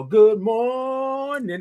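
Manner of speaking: high, long-held MORNING greeting; drawn-out morning. Real, not acted. Host greeting viewers by name, morning drawn out. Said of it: "like all those O's and R's".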